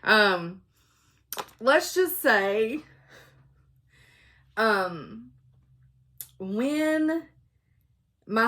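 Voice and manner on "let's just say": ordinary talk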